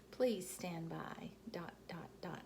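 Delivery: slow dramatic whisper. Acted. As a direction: hush it out slowly like a cliffhanger. Journal ending, dramatic pause.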